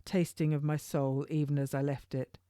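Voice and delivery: ordinary delivery, nothing marked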